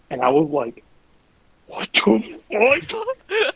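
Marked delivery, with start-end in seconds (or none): 1.7-3.6 slow, high, drawn-out what the fuck; dragged-out stunned disbelief. Retold or imitated. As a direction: stretch it out in shock. Reacting to the creepy letter.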